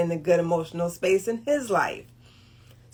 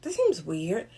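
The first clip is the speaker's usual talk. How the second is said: wary; 4 semitones lower, 8 dB quieter, slower than usual. Retold or imitated. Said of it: "I'm thinking".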